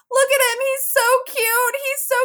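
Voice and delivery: very high-pitched mock gushing; gushing mom imitation. Imitating mom in the birth video, gushing. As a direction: coo excitedly in a high imitated voice.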